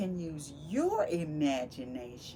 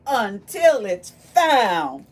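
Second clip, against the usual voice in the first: slow and louder; dramatic reveal; 3 semitones higher, 11 dB louder, slower than usual. Acted. Teacher revealing the stick is found, slow and loud. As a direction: draw out the reveal slowly.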